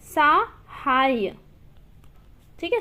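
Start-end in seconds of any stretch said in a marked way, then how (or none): 0.0-1.3 सहाय drawn out very slowly, sa-haa-ya; slow demonstration. Shown as a demonstration. Teacher modelling the word for students, very slow. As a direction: stretch सहाय syllable by syllable. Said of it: "तो ऐसा होगा सहाय या फिर सहायता".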